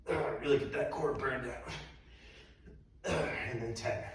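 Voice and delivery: panting between words after exercise; winded, slow and soft; out of breath. Real, not acted. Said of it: "I'm already out of breath here".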